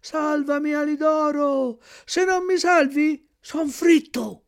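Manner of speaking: high, thin, pleading puppet voice; a tiny desperate cry for help; faint little voice. A narrator's reading. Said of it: "una vocina fioca fioca che disse". (vocina fioca e supplichevole)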